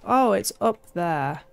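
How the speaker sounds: ordinary talk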